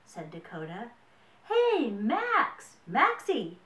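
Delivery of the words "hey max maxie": loud, high call with a drawn-out ending. A narrator's reading. Brody looking for Max, calling out. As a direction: call loudly for someone out of sight. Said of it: "called Brody".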